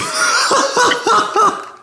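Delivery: loud squealing laugh; high laugh burst. Real, not acted. Laughing at themselves.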